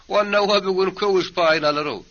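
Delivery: high, loud taunt; jeering heckle. Acted. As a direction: pitch it way up and jeer for the crowd. Men jeering at the husband. (ýokary ses bilen masgaralamak)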